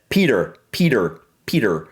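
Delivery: "Peter" with a D-like T and sounded R; American pronunciation. Shown as a demonstration. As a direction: soften the T in "Peter", American style. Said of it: "For me, it would be".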